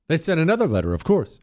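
ordinary talk, nothing marked

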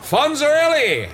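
high, drawn-out wizard's cry; shouted magic word. Acted. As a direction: call it out like casting a spell. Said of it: "and I shouted".

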